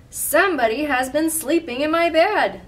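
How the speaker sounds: loud, high outcry from the big bear; great big Papa Bear voice. A narrator's reading. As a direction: boom it out as a big storybook bear. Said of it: "in his great big voice".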